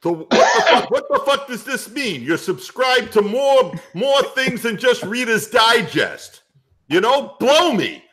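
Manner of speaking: put-on high, indignant New York accent; New York guy. Answering a heckling panelist, exasperated New York accent.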